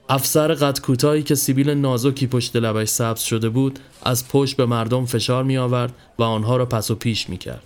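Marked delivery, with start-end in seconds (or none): none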